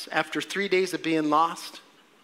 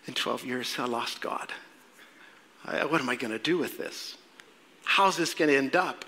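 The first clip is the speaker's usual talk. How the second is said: low, hushed acted musing; murmured private dread, played out; worried inner thought; 4 semitones lower, 6 dB quieter than usual. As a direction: think aloud softly as a lost parent. Parent's anxious inner thought.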